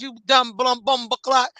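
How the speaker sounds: lightly put-on Jamaican accent; Jamaican movie gangster. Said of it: "is it New Jack City with the, with the Jamaicans".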